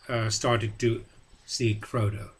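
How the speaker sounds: ordinary delivery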